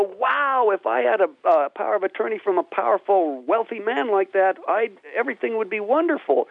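high, eager mock amazement at riches; awed dreamer. Imitating people dreaming of a rich man's backing, eager and high. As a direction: imitate someone dazzled by a rich man's favour. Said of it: "See, people would go".

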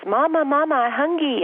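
put-on high little toddler voice calling mama. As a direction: imitate a two-year-old asking for food. Mother voices her toddler daughter.

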